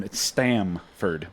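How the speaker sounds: "Stamford" slow and firm, the M clearly sounded; "Stamford" drawn out with a firm M; Stamford stressed. Shown as a demonstration. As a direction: insist on "Stamford", STAM-ford spelled out. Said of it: "It's with an M".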